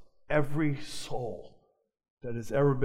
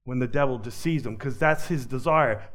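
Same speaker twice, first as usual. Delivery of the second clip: usual speaking voice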